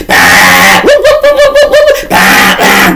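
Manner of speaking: loud high whooping siren noises; siren imitation. Comic driving to court, mimicking the police siren loud and high.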